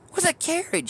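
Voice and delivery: very high, incredulous squeak; can't believe what she means; baffled question. Retold or imitated. To a Boston coworker, squeaking high in total confusion.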